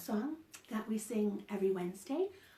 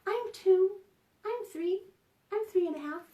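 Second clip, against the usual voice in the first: put-on high, loud little child voices; imitating kids shouting their age; little kids calling out; 8 semitones higher, 5 dB louder than usual. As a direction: pipe up like excited toddlers. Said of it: "I hear little voices call out".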